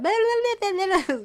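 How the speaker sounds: put-on a high, giggly run of baby gibberish; imitating toddler babble. Mother imitating her toddler's babbling, high and giggly. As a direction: mimic a toddler's made-up words, high and bubbly. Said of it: "y hacía".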